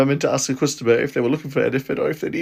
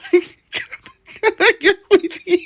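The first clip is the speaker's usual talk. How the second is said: higher and very slow, laughing through it; slow laughing slip; 13 semitones higher, 4 dB louder, much slower than usual. Shown as a demonstration. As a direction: drag out the slip while cracking up. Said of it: "I said".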